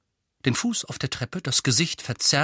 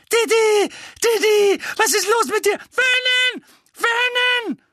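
very high panicked shriek; shrieking mother; 7 dB louder than usual. A narrator's reading. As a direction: shriek for help, high and loud. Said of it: "Tante Petunia kreischte".

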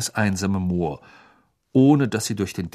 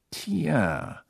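thoughtful; 3 semitones higher, 4 dB quieter than usual. A narrator's reading. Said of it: "sagte er nachdenklich".

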